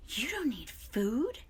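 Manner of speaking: slow whispered surprise; whispering fox. A narrator's reading. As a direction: hush it in disbelief.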